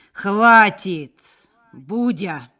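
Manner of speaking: loud, menacing cut-off; sharp, loud warning to stop; threatening. A narrator's reading. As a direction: shut him up with a forceful threat. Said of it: "с угрозой сказал Николай".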